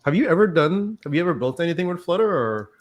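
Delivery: ordinary talk, nothing marked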